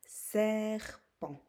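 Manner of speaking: "serpent" drawn out in two beats; syllable by syllable. Shown as a demonstration. Teacher naming the second picture, syllable by syllable. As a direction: split "serpent" into ser, pent. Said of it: "la première syllabe ou dans la deuxième".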